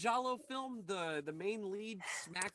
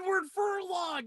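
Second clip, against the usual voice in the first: excited recognition; 10 semitones higher, 5 dB louder than usual. Real, not acted. Finally recalls the forgotten name.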